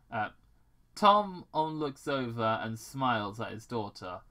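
ordinary delivery

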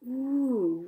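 storybook wonder; surprised. A narrator's reading. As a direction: let the ooh swell in surprise. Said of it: "and her surprised".

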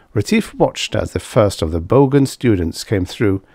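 ordinary delivery

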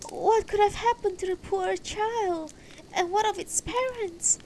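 very high, plaintive woman's voice; grieving wife. Acted. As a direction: lament in a high, pitying voice. Grieving wife pities the child.

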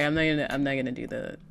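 ordinary talk, nothing marked

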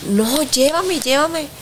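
high, urgent repeated plea; pleading as if the words could change it; desperate begging. Retold or imitated. Widow begging the friend to take her to her husband, high pleading.

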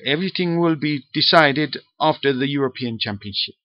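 hammed-up Russian accent, quoting a footballer; Andrei Arshavin Russian accent. Fan vlogger quoting Arshavin on his future, mock Russian accent. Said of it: "That's not the best Russian accent in the world".

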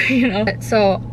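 ordinary delivery

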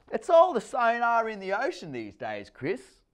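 put-on high, loud know-it-all girl; stepdaughter's voice. A narrator's reading. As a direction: explain it to the little boy.

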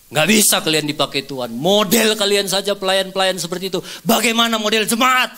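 retold rebuke rising to a shout; indignant preacher's telling-off; angry scolding. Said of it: "marah karena model pelayan seperti kalian".

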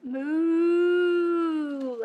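long drawn-out moo; cow moo. Shown as a demonstration. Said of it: "What sound does a cow make?".

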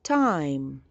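time said slowly with a long I sound; spoken model word. Shown as a demonstration. Teacher models time for the class, slow and clear. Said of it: "The E sound becomes an I".

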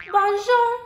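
a cheerful French "Bonjour" with a high lift; French greeting. Acted. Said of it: "That's French for hello".

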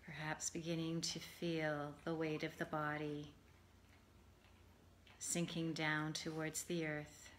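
calm, measured meditation voice with long pauses; guided meditation. Acted. Yoga teacher settling listeners for Yoga Nidra, guided meditation. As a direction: ease them gently into rest, phrase by phrase.

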